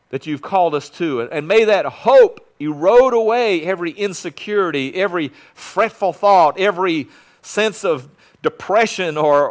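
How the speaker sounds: loud, driving prayer at full voice; fervent prayer. Real, not acted. Pastor leading the congregation in prayer, fervent full-voiced prayer. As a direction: pray it out over the whole room.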